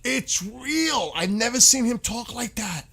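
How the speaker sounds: ordinary delivery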